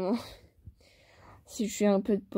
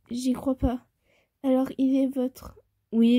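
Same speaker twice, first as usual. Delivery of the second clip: ordinary delivery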